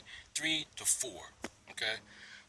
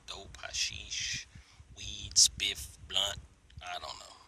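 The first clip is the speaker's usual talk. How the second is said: whispering; 4 semitones lower, much slower than usual. Real, not acted. Whispering drug names.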